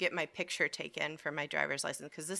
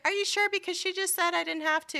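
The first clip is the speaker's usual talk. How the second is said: much higher, squeaky disbelieving question; incredulous; 13 semitones higher than usual. Retold or imitated. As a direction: act stunned and a little indignant. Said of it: "And I was like".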